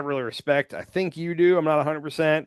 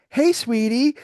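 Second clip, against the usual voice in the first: mock lovey-dovey voice, pitched way up; sweet-talk phone voice; 11 semitones higher, 4 dB louder, slower than usual. Guy answering his sweetheart's phone call, high sweet-talk voice. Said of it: "where did that voice come from".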